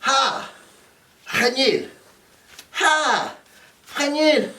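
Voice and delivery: high, insistent squabbling shouts; mock argument. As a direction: fight over the CD, half-hammed. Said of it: "an argument over whose C D".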